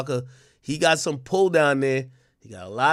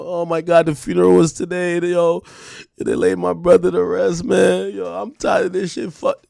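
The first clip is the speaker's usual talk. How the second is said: loud, higher-pitched tearful wail, put on; mock crying; 4 semitones higher, 5 dB louder than usual. Acting out that tearful moment, mock crying. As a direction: imitate a grieving man's outburst, raised voice. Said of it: "he's crying and like".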